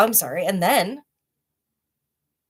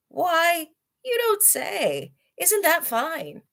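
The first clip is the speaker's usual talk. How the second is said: delighted tiger; 11 semitones higher, much slower than usual. Acted. Tiger delighted by gum story.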